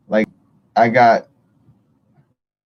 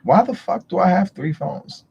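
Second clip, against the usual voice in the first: higher, baffled outburst; exasperated self-quote; 8 semitones higher than usual. Retold or imitated. Telling viewers her own baffled outburst, pitched high. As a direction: throw the question up in disbelief. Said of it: "so I'm like".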